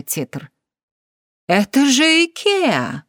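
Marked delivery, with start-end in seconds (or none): 1.5-3.0 shocked cry. Acted. Gail shocked at screen.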